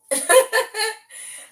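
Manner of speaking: loud high cackle; high burst of laughter. Real, not acted. Laughs at own joke about moderators.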